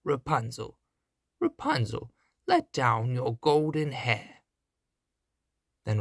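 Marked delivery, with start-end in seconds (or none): 0.0-4.4 witch calling. Acted. Witch calls up the tower.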